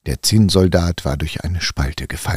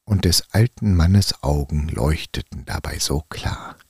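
plain reading voice

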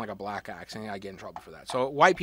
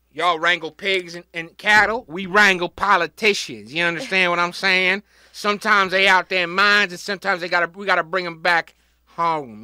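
mock wrangler; 2 semitones higher, 8 dB louder than usual. Podcaster playing a wrangler of politicians, loud folksy holler. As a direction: holler it like a folksy ranch hand.

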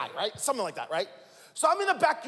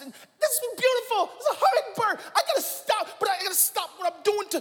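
much higher, excited; excited kids; 10 semitones higher than usual. Retold or imitated. Kids calling dad to see a hummingbird, excited and high. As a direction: gush about the hummingbird. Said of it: "my wife and my kids will be like".

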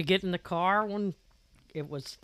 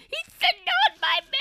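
put-on very high, loud whiny kid voice; high screechy voice; 7 dB louder, faster than usual. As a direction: squeal it high like an upset little boy. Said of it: "Ty had this really high, screechy voice".

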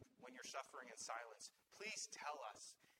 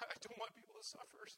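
crying; 6 semitones higher, 3 dB quieter than usual. Real, not acted. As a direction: voice raised and strained with tears. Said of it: "I'm crying 'cause".

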